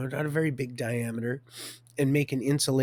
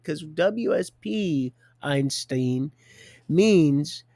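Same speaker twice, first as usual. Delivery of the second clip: mock condescension; 4 semitones higher, 5 dB louder, much slower than usual. Officers mocking him, retold.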